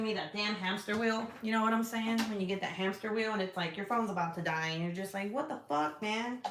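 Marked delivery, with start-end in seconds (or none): 5.3-6.4 high-pitched mock whine of disbelief; mock exasperation. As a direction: imitate someone fed up with their phone. Mimics reaction to dying phone.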